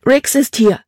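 urgent exclamation. Acted. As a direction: call out the alarming news. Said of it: "rief sie aus".

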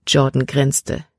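ordinary delivery, nothing marked

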